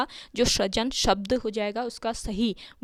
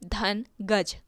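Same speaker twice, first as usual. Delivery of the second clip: दिक धन गज said slowly, each part set apart; slow split; much slower than usual. Shown as a demonstration. Teacher slowly splits the word for the students. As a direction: break it into दिक, धन, गज one piece at a time. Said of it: "दिग्गज का हम संधि विच्छेद करें".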